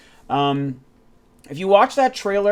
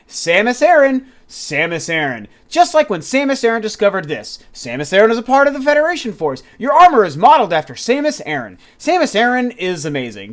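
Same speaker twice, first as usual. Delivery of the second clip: mock trailer hype, mostly high and loud; insistent name-dropping; 5 semitones higher, 5 dB louder than usual. Gamer mocking a trailer's repeated plugs for Samus, overemphatic. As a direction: hammer the name in an exaggerated imitation. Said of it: "They kept saying".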